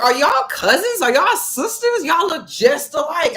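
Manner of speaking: high, loud surprised question; amazed stranger. Retold or imitated. Man at the dealership asks if they're sisters, high amazed voice. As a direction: pitch it up like a stunned bystander.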